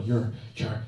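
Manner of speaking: ordinary talk, nothing marked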